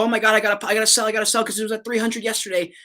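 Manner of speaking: half-hearted panicky rush to sell; mock panic. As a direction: play the freaked-out seller who must sell now. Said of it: "everyone's freaking out".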